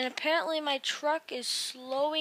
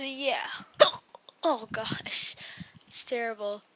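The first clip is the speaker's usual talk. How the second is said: ordinary talk, nothing marked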